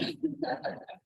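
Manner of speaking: high burst of real laughter. Real, not acted. Laughing at the awkward moment.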